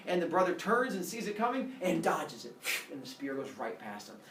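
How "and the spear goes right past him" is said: usual speaking voice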